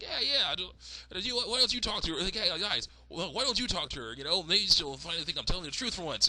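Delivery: high, hurried insistence; put-on girl voice. Daughter asks captors to talk to mom, high urging. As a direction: urge them on in a high girlish voice.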